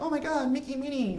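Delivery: put-on high, thrilled kid squeal; excited kids. Kids at Disneyland squealing at the fireworks, high. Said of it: "They're like".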